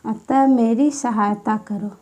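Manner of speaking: ordinary talk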